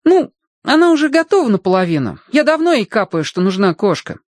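put-on high-pitched boy making his case; piping young persuader; persuading boy. A narrator's reading. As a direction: plead the case in a high lad's voice.

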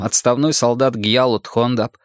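ordinary talk, nothing marked

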